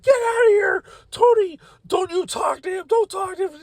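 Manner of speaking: very high-pitched mock shriek; retold yelling. Boss warning coworker off talking, imitated yelling. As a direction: imitate a frantic boss screaming orders. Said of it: "she's still yelling at me".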